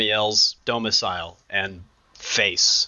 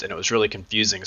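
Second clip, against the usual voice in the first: ordinary talk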